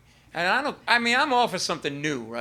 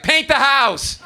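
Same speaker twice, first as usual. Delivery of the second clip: louder and higher, a curt dismissive command; blunt dad order; 7 semitones higher, 8 dB louder, much slower than usual. Retold or imitated. Father brushing off his son's question, loud blunt order. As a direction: shut the talk down with one loud order. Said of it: "He's like".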